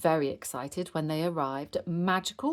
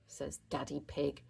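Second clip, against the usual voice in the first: ordinary delivery, nothing marked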